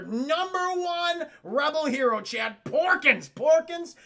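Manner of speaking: big hyped hero introduction, high and loud; hyped announcement. Real, not acted. Streamer introducing a rebel hero to chat, hyped. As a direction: announce a name like a star athlete.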